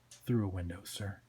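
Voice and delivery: low, soft, deferential correction; understated, obedient sidekick; meek deputy Twitch. Acted. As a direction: answer the boss quietly and dutifully.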